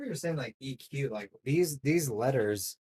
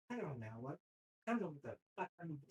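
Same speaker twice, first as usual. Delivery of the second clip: mumbled confused student; 2 semitones higher, 11 dB quieter, much faster than usual. Retold or imitated. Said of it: "He's like".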